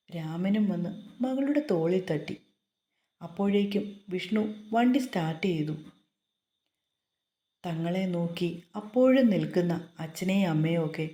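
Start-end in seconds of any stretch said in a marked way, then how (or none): none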